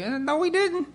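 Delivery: very high, small reply; imitated high-pitched comeback to an angry dad; high-voiced denial. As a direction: squeak out a contradiction. Meek retort to angry dad.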